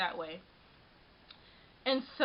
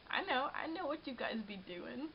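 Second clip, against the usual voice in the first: softer, mock street-slang voice; put-on slang voice; 9 dB quieter than usual. Teasing subscribers about hunting cute guys, mock slang voice. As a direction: play it cool in a slang voice she never uses. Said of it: "I don't even talk like that".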